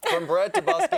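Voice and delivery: laughing through the line. Real, not acted. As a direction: burst out laughing while saying it. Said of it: "I can't do this with a full mouth".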